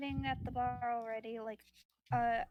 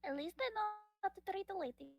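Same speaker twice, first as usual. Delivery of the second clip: quick character voice; 6 semitones higher, 3 dB quieter, faster than usual. Acted. Gnome quips in character.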